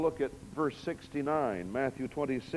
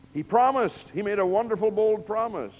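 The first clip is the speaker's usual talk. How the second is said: loud, high emphatic preaching; preaching at full force; 7 semitones higher, 9 dB louder than usual. Real, not acted. Preacher stressing Peter's promise to the church, loud. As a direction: drive home the bold promise.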